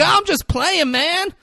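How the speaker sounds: high, loud call; mock yelling. Imitating the client calling after departing Marty, yelled. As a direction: shout it playfully across a room. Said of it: "and he's screaming this".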